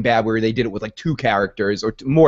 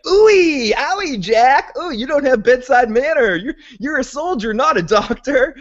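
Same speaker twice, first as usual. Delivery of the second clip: high, whiny teasing voice; mocking Heller; 12 semitones higher than usual. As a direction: squeal it like a wincing wimp. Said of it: "He's like".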